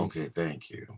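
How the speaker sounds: low, soft thank-you; deep voice for women. Acted. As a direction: drop the voice low and smooth. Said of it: "He uses his deep voice".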